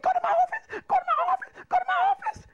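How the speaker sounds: very high, repeated mock command; caricatured high-pitched outburst; imitating the irate principal. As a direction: squawk the order three times as the principal. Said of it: "he jumped up and he said".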